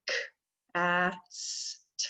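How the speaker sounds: "cast" broken into k, a, s, t, each sound alone; segmenting into sounds. Shown as a demonstration. Teacher leading students to segment cast, sound by sound. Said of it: "Let's say the sounds in cast".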